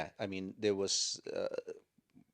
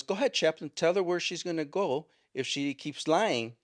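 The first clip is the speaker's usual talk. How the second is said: higher, louder, egging the chaplain on; pushier deputy retold; 7 semitones higher, 4 dB louder than usual. Deputy daring the chaplain to answer the lying woman, higher and louder. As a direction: push the line, mock insistent. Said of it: "he goes".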